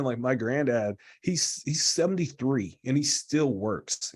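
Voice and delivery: usual speaking voice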